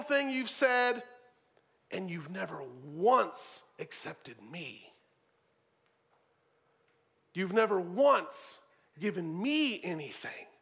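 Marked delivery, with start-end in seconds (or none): 1.9-10.5 bitter hurt. Retold or imitated. The older brother telling his father of his hurt, bitter. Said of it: "See how bitter he is".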